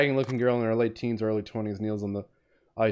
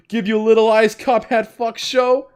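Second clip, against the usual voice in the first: high, loud, hammed-up outrage; losing his mind; 9 semitones higher, 7 dB louder than usual. Cop losing his mind at the girl, loud unhinged indignation. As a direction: rant it like someone coming unhinged. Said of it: "a man who's losing his mind".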